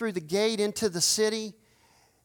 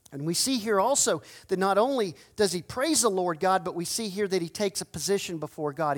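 ordinary talk

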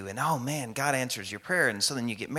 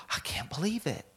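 gasp, then higher-pitched disbelief; mock shock; 5 semitones higher, 4 dB quieter than usual. Everybody reacting to a sudden conversion, in mock shock. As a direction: gasp like a scandalized onlooker, hammed up. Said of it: "Why are we shocked?".

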